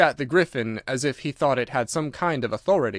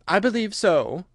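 put-on mildly thoughtful, higher girl's voice; 7 semitones higher than usual. A narrator's reading. Alice answers the Mock Turtle, mildly thoughtful. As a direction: ponder it lightly, pitch lifted. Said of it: "Alice replied thoughtfully".

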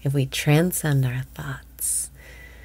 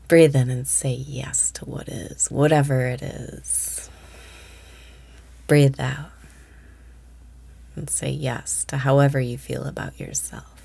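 whispered meditation. Acted. Meditation cues resume.

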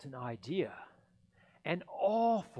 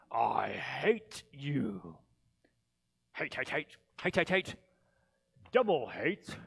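a sour, spiteful Grinch muttering names; grumbling Grinch; 7 dB louder than usual. Acted. The Grinch reading the Whoville directory, sour and spiteful. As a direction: spit out each hate with relish.

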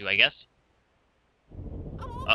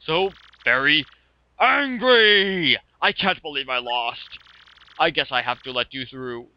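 angry sore loser; 5 semitones higher, 4 dB louder, slower than usual. Acted.

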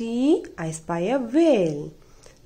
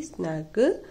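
tender bedtime; 8 semitones higher, slower than usual. Acted. Bedtime goodnight to husky.

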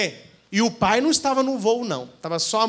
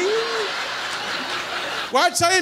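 surprised; 9 semitones higher than usual. Retold or imitated. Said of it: "eu falei".

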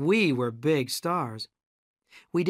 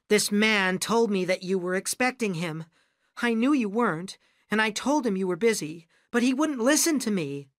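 high-pitched, anxious protest; flustered excuse; 7 semitones higher than usual. A narrator's reading. Secretary explains intruder to his boss, flustered. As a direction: plead your excuse in a high voice.

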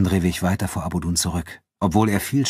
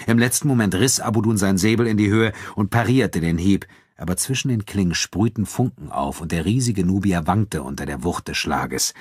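ordinary delivery

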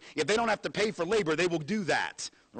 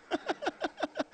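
high, breathy burst of laughter; short scoffing laugh; incredulous laugh; 6 dB quieter than usual. Real, not acted. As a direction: laugh out at the absurdity. Laughs at the idea.

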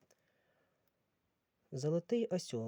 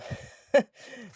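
burst of laughter; 10 semitones higher, 13 dB louder than usual. Real, not acted. Laughs at a funny book title.

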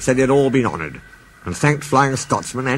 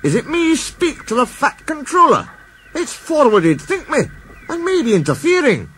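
a much higher, bashful Scots voice; Scottish engine. Acted. Douglas hesitates to face the Fat Controller, in a high Scottish voice. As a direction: Douglas shies from seeing the boss.

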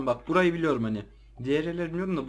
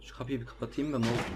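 ordinary talk, nothing marked

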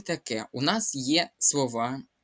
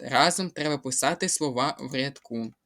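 ordinary talk, nothing marked

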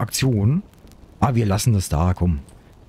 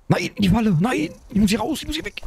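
panicked; 8 semitones higher than usual. Real, not acted. Trapped, panicking in game.

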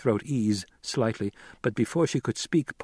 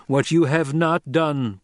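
slow, louder, higher accusation; grave reproach; 5 semitones higher, 6 dB louder, slower than usual. Acted. Son resuming his rebuke of the captor, forceful. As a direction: hammer each word deliberately. Narrator voices Paul reproaching.

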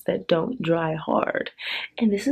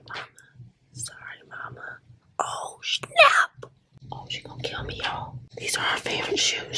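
soft voiceless whisper, much softer; 6 dB quieter than usual. Real, not acted. Whispering to viewers about her mom's shoes while mom sleeps. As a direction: keep it hushed so mom won't wake. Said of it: "I'm whispering 'cause the old lady's asleep".

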